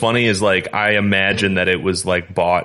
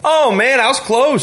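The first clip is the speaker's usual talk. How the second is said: excited near-miss; 4 dB louder than usual. Real, not acted. Guess nearly matched sale price.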